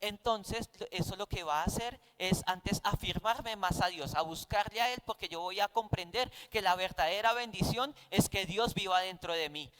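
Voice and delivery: ordinary delivery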